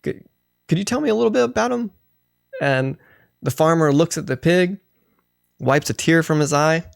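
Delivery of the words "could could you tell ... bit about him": quick curious question. Acted. Visitor asks farmer about pig.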